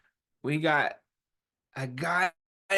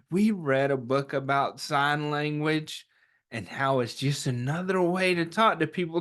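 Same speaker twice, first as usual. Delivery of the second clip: ordinary delivery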